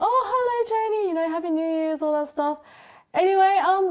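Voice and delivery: sweetly put-on voice, acting friendly on the phone; high-pitched sing-song phone greeting. Friends on the phone greeting the brother, high sweet voice. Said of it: "And we're like".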